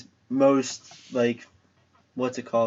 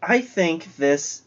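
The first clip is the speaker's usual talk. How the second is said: normal talking voice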